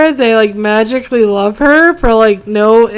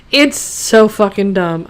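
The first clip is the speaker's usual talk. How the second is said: normal talking voice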